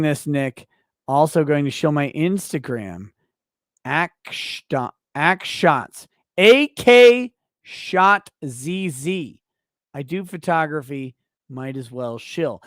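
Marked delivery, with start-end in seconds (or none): 3.8-9.4 slow, spelled-out letters "A K Shot Z Z"; spelling it out slowly. Shown as a demonstration. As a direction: dictate each letter clearly so they can type it. Dictating the Instagram handle.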